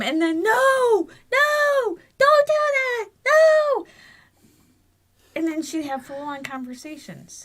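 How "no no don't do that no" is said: high, loud night-terror screams; child screaming in sleep. Retold or imitated. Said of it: "she would scream".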